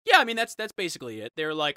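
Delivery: ordinary talk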